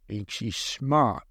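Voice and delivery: low, drawn-out sneer; sneering captor. Acted. Third captor belittling the captive, sneering.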